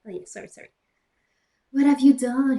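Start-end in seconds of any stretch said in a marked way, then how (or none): 1.7-2.6 mock crying outburst. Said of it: "he started to cry wisely".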